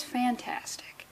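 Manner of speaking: hushed, drawn-out whisper; softly breathed mock wonder; mock awe. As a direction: whisper it slowly, as if reading a palm. Mock palm-reading prediction.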